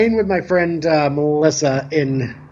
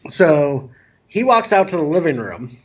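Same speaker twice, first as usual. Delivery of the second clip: normal talking voice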